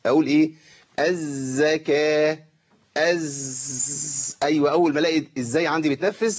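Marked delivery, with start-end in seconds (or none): 1.0-4.4 slow الزكاة, voice unbroken on the z; sustained voiced zay. Shown as a demonstration. Teacher demonstrating jahr, long voiced z in الزكاة. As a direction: hold a long buzzing z in الزكاة. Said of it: "يعني ما أقطعش النفس".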